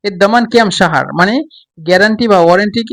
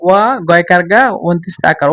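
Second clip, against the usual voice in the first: ordinary talk, nothing marked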